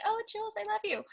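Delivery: imitating a sappy apology, high and soft; mock sweet Rue. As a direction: coo it like a fake make-up line. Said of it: "be like".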